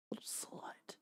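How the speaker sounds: breathy teasing whisper. Pretend. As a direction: whisper it like a secret.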